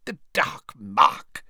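slow, drawn-out mutter to himself; musing, stretched-out character mutter. A narrator's reading. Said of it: "he muttered".

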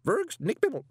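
high, loud garbled squawk; muffled tube expletive. A narrator's reading. Hugo swears via the speaking tube, garbled and squawking. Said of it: "He heard the muffled expletive".

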